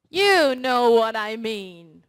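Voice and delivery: YOU punched, loud and slow; stressed and loud. Shown as a demonstration. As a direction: lean hard on the word You, slow and loud. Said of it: "I was also stressing".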